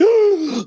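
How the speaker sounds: high, loud startled gasp; sharp gasp. Retold or imitated. Friend spotting a woman in the mirror, sharp gasp. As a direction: gasp as if seeing a ghost. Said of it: "He's like".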